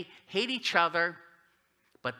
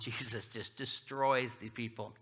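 ordinary talk, nothing marked

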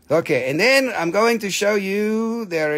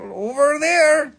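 "over there" drawn out and higher; emphatic; 9 semitones higher, slower than usual. Shown as a demonstration. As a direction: stretch "over there" slowly, lifted. Repeats pointing phrase louder.

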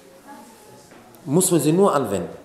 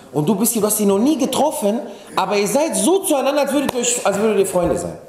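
staunend; 7 semitones higher than usual. Retold or imitated. Staunender kleiner Bruder, zitiert.

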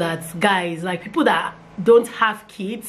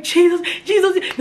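higher, amazed Jesus, Jesus; imitating overjoyed husband; 10 semitones higher than usual. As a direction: mimic the husband's stunned joy. Husband's reaction retold.

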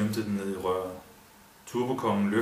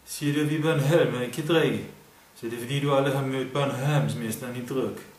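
put-on higher Bornholm accent, bragging; 4 semitones higher, 3 dB louder than usual. A narrator's reading. Kurt brags to the drunk man in Bornholm accent. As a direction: brag in Bornholm dialect. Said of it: "den bornholmske accent".

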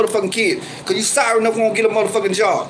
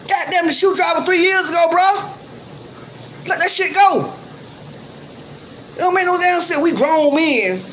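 fed-up shout; 7 semitones higher than usual. Real, not acted. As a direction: yell at him to let it go. Yelling at rival to move on.

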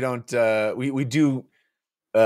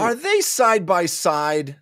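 pointed question; 8 semitones higher, 3 dB louder, slower than usual. Acted. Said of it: "Slick Palm asked".

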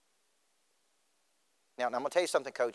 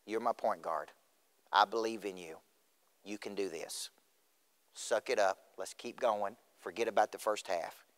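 low, softer, steadying encouragement; reassuring coach; 4 semitones lower, 5 dB quieter than usual. Retold or imitated. Coach steadying a rattled point guard, reassuring. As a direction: settle the player down with quiet belief. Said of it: "What I needed him to say was".

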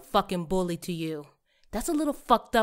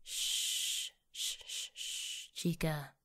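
soft hushing to calm a crying friend; quiet comforting shh; soothing shush; 4 semitones lower, 10 dB quieter, much slower than usual. Acted. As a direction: shush her gently like a child. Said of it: "Marcia then knelt down beside the crying Anita, embraced her, and began stroking her hair".

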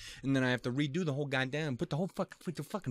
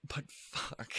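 whispered, softer despair; heartbroken whisper; 5 semitones higher, 7 dB quieter than usual. Real, not acted. Watching the sad character's scene, a soft whispered curse. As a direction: breathe it out, defeated.

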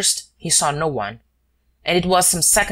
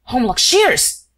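high, loud strangled gasp; choked gasp of recognition; a throttled friend gasping the name; stifled gasp; 9 semitones higher, 5 dB louder, slower than usual. Acted. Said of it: "gasped the hollow, stifled voice".

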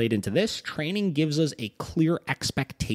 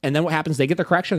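ordinary delivery

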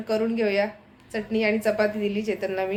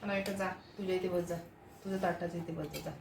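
softer and quick, telling the little one to go sit; quiet hurried aside; 2 semitones lower, 13 dB quieter, faster than usual. Real, not acted. Mother hurrying her daughter to her plate, softer and fast.

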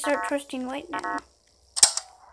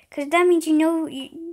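ordinary delivery

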